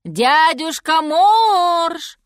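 very slow, high, loud call; long hailing call to a sleeper; drawn-out shout. A narrator's reading. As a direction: stretch the last word as you holler. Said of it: "крикнула медведица".

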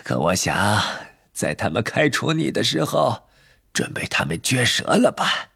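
put-on old wandmaker turning grave; suddenly serious. A narrator's reading. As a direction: sober up as you question him, in an old man's voice. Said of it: "突然变得严肃起来".

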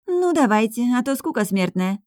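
high, languid assent; lazy agreement. A narrator's reading. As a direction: agree as if bored to death. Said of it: "лениво поддержала Ромара". (лениво, со скукой)

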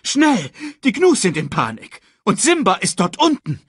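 high, alarmed report; urgent call. A narrator's reading. Scar tells Mufasa that Simba is in danger, calling urgently. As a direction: rush in with terrible news, pitched high. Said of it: "rief er".

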